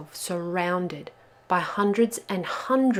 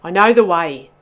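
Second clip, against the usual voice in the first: loud, firm insistence; insistent; 14 dB louder than usual. A narrator's reading. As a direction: declare it loudly, sure of yourself. Bubba insists they aren't lost.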